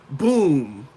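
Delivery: loud, drawn-out comic boom; Batman sound effect. Shown as a demonstration. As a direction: boom it out like a cartoon punch. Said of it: "I want you to have some Batman sound effects".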